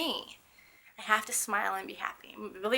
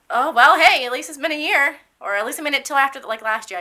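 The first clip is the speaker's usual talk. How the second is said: loud, higher, jokey retold quip; off-the-cuff joke; 5 semitones higher, 13 dB louder than usual. Wheelchair patient cracking a joke at the hospital, loud joking. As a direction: toss out a joke loudly, pitched up. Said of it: "crack a off the cuff joke".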